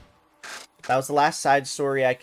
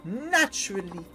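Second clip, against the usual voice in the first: higher, hammed-up broken-English foreign accent; a foreign diva's shaky fake accent, higher pitched; questionable foreign accent; 3 semitones higher than usual. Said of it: "my questionable accent of the day".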